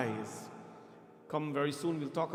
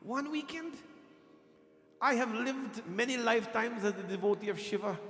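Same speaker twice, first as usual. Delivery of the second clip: incredulous; 6 semitones higher than usual. Retold or imitated. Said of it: "I said".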